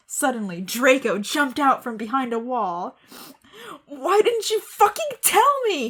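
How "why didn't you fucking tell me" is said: high, hammed-up shouted accusation; mock shout. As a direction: yell it like a melodramatic hurt boyfriend. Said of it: "he shouted".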